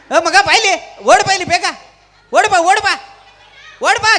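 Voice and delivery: loud, high summoning call; urgent shout across the stage. Real, not acted. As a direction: holler for someone to come running. Calls helpers onto the stage.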